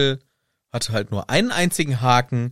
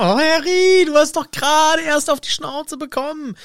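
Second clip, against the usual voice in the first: high-pitched, wailing scolding; hammed-up despairing telling-off; exasperated reproach. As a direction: moan at Harry in an exaggerated high voice. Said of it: "aber da hab ich gedacht".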